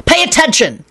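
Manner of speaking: high sharp shout. Acted. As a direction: bark the order. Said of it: "Lupin shouted".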